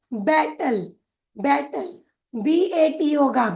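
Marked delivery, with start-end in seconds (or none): 0.1-1.9 बैटल as "bai-tal" with a single t; word demo. Shown as a demonstration. Teacher pronounces the new word for trainees, clearly. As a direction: model बैटल, the le ending as a plain l. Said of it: "इसका उच्चारण होगा".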